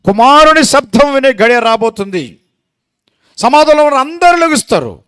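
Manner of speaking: high, loud proclamation; preaching at full force. Real, not acted. Preacher proclaiming the resurrection, high and loud. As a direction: thunder it out to the crowd.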